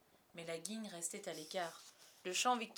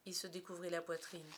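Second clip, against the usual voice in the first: plain reading voice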